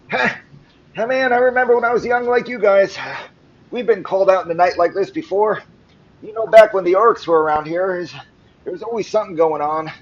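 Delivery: put-on high, loud old-timer voice; talkative old man. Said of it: "an overly talkative old man".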